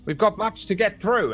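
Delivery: high-pitched, impatient falcon; Nimble Chan. Acted. Falcon overhead calls the group to order, high and brisk. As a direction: keep them in line in a high bird voice. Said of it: "Nimble Chan flying above".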